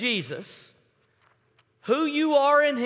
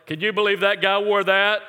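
imitating non-whisperer; 2 semitones lower, 4 dB louder than usual. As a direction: mimic an indiscreet friend commenting on someone. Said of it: "had no gear for whispering".

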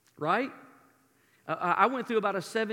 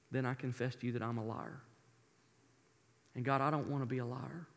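hushed confession; 7 semitones lower, 9 dB quieter than usual. Shown as a demonstration. Voicing a confessional prayer.

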